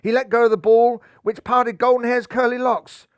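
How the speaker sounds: ordinary delivery, nothing marked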